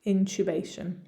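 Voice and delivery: British "intubation" with CHOO as in Tuesday; British accent. Shown as a demonstration. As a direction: say "intubation" the English way, in-CHOO-bay-shun. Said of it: "same sound that I use in the word Tuesday".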